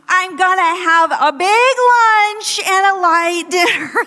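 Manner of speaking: loud, high, slow speakerphone voice; speakerphone shouting. Retold or imitated. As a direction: belt it out like she's on speakerphone in the store. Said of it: "That's kind of me. voice just goes up".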